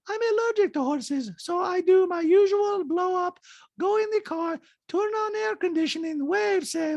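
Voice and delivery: put-on very high-pitched accented caricature; squeaky falsetto mimicry of a reality star; Diana impression. Host mimics reality star in recap, high-pitched caricature. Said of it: "Diana Natagnia goes".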